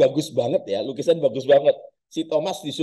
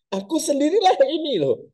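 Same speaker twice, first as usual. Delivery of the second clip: Jesus insisting to scared disciples, exasperated; exasperated insistence; 11 semitones higher than usual. Acted. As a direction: insist it is really you, fed up.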